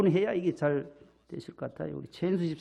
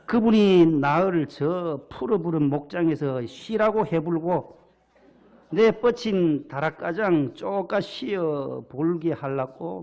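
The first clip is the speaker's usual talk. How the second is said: put-on slow, drawn-out Jeolla accent; a Gyeongsang speaker trying on Jeolla dialect; imitated Jeolla dialect; 3 dB louder, slower than usual. Pastor from Gyeongsang attempts the Psalm in Jeolla dialect. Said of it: "저는 경상도라서 이게 잘 못하겠는데".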